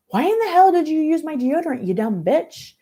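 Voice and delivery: high angry shout at a housemate; angry yelling. Acted. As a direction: scream the insult in a rage. Kelly fighting in the hall.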